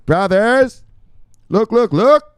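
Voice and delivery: high, loud hail to the crowd; excited calling out. Real, not acted. Host rallying his brothers to look, shouted high. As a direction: call them in at full voice.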